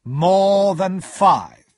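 slow, booming repeat of the amount. A narrator's reading. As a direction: stretch every word out weightily. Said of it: "Mister Jaggers said slowly".

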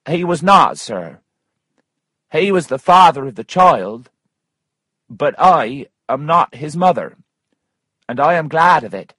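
a woman firmly setting the record straight; emphatic Missus Waters. Acted. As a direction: pitch it up and insist. Narrator voices Missus Waters.

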